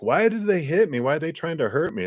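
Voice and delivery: high, startled cry of confusion; sudden high-pitched confused protest; bewildered alarm. Retold or imitated. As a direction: sound hurt and baffled as you get hit. Confused rescuer voiced in drowning story.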